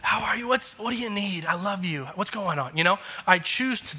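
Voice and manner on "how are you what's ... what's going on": put-on beam at your little boy; delighted dad. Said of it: "I'm like".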